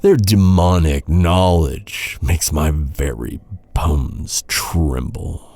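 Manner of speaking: usual speaking voice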